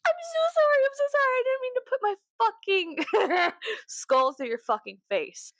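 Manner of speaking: very high, quick squeaky apology; mock groveling. Groveling to someone she head-butted, a squeaky mock apology. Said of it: "grovel and be like".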